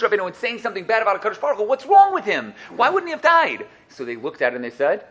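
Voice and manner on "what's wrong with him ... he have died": normal talking voice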